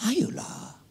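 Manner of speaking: breathy whispered plea to the crowd; soft pleading whisper; coaxing whisper. Real, not acted. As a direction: whisper it, urging them on. Preacher coaxing the congregation.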